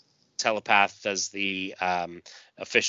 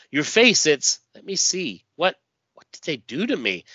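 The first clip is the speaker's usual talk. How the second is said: panicked; 6 semitones higher than usual. Acted. Stealth panics about face.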